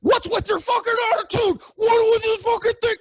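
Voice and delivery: high, loud, mocking screech of the angry man; imitating a man freaking out at the bank; mock furious shout. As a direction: screech it like he's losing it. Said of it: "as he goes".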